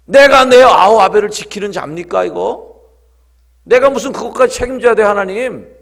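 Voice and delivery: loud, high mock objection to God; defiant protest. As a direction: throw the responsibility back at God. Voices Cain-like excuse to God. (하나님께 따지듯 큰 소리로)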